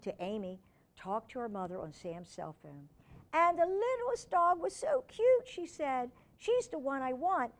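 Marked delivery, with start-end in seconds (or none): 3.3-7.5 put-on high pleading little girl; begging girl. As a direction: beg Mom sweetly. Amy begging her mother.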